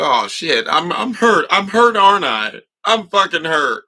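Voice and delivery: loud high mock-panicked baby; dawning alarm as a toddler; baby realizing it's hurt, loud and high; mock baby realization.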